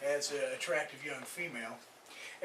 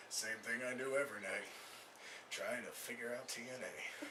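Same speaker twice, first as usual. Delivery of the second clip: low, quiet, deliberate reply; deadpan cartoon parody; 5 semitones lower, 11 dB quieter than usual. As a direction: answer low and measured, slowing down. Answers girlfriend, Pinky and Brain parody.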